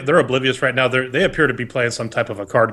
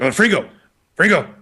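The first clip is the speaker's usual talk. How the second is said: calling out searching; 4 semitones higher than usual. Acted. NPC searching for a friend.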